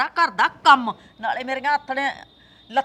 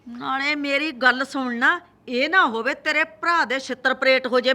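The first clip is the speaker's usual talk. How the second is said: usual speaking voice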